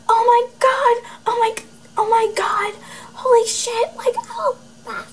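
very high shrieking mock excitement; mock ecstatic squeal. Imitating the girl opening her scores, very high mock squeals. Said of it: "she's like".

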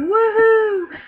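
high, loud whoop; mock cheer. Friends jumping off a bridge, imitated cheer. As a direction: whoop it like someone leaping.

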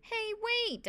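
high, pleading call; crying out. A narrator's reading. Boy left hanging calls after the others, crying out. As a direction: call after them, pitch way up. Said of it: "I cried".

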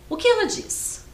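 ordinary talk, nothing marked

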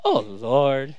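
low, weary mock dismay; exasperated parent. Parent walking into a living room full of toys, exasperated. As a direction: let it sink, fed up. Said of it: "all the toys are all".

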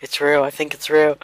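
ordinary delivery

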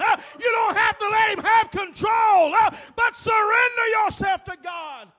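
high, loud, with a gasped uh ending each phrase; fiery preaching. Real, not acted. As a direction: preach it full force to the congregation. Preaching at full force.

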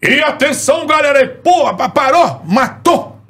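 very high, punchy TV host impression; Faustão. Imitator demonstrating a TV host for the radio man, high-pitched impression. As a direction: call the crowd to attention in stretched high bursts. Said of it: "imita o Faustão aí".

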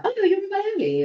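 high quick imitated delight; mock surprise. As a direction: squeal it like a stranger who just spotted her. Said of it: "they're like".

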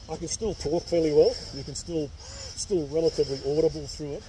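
muffled behind respirator; speaking inside breathing mask; through a face mask. Real, not acted. Seen 0: Clear half mask over his nose and mouth throughout.

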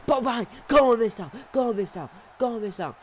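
high, loud cheer for Mizdow; excited cheering. Real, not acted. Commentator cheering his underdog wrestler, excited and high. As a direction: roar him on like a fan.

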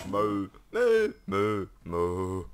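playful mooing like a cow; silly cow lowing; mock cow moo. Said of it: "kon låter så frisk om det låter så".

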